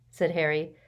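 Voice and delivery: ordinary delivery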